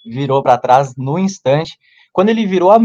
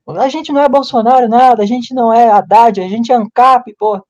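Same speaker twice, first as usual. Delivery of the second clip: retold drunk outburst; 8 semitones higher, 3 dB louder than usual. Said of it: "comecei a falar alto".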